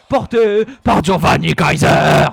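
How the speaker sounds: slow and loud, drawn out; commentator shout. Real, not acted. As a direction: bellow the move like a ringside caller. Hypes a wrestling move.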